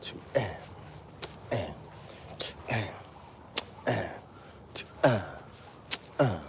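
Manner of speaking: soft count on each and; muttered beat count. Shown as a demonstration. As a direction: mark the and quietly. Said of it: "acknowledge the and of beat one".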